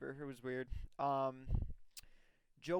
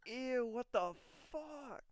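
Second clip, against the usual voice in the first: higher, slow put-on voice of a disgusted girl; grossed-out girlfriend; 10 semitones higher, slower than usual. Girlfriend doing homework reacts to the clip, higher and slow. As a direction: drag out the ew in mock disgust. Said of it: "she was just like".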